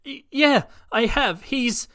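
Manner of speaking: high, nervous stammer; stammering kid. Acted. Midoriya stammering to All Might, high nervous stammer. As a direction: caught between telling and hiding.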